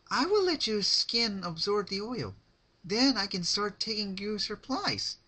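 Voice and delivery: high, sing-song sweet menace; bright cheery threat, voiced high; Pinkie Pie voice. Narrator gives Pinkie a high sweet creepy voice.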